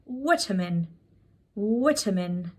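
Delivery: "vitamin" with a short i, VIT-uh-min; first vowel of "vitamin" as in "sit"; British pronunciation. Shown as a demonstration. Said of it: "British English that might be possibly pronouncing wrong".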